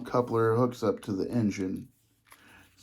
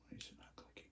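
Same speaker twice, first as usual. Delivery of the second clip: frustrated whisper; 27 dB quieter, much faster than usual. Real, not acted. Struggling with a coupler.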